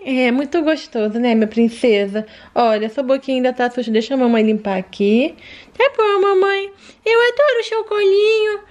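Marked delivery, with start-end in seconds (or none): 5.8-8.6 put-on high childlike voice of a small daughter; sweet little daughter asking mommy for more; little girl voice. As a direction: play the sick little girl talking to mom. Daughter doll in mom's lap.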